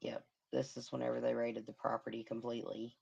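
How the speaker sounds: ordinary delivery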